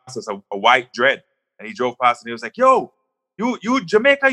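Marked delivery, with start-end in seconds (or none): 2.6-4.3 high, put-on voice of a dreadlocked guy; imitating the driver. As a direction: call out like the excited stranger.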